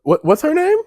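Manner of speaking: high, loud disbelief; shocked. Real, not acted. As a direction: squeak it out in shock. Shocked reaction to profile.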